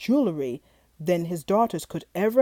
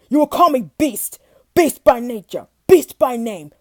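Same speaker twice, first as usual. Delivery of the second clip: loud, furious monster voice; angry beast; 8 dB louder than usual. Acted. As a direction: roar the words at him. Beast rages at the father.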